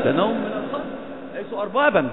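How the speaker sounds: ordinary talk, nothing marked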